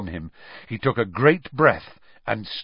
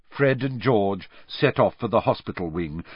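usual speaking voice

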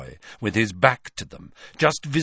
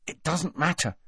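higher, hushed impatience; impatient; 7 semitones higher, 5 dB quieter than usual. A narrator's reading. Harry dismissing Hermione's worry about feet, impatient. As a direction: brush the worry aside, voice pitched up. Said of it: "said Harry impatiently".

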